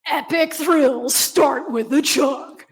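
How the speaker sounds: slow, drawn-out slogan delivery; mock extreme ad voice; hyped ad read. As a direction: sell it like an over-the-top soda commercial. Reads out a soda ad's slogan.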